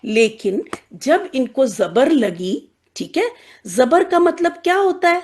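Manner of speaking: ordinary delivery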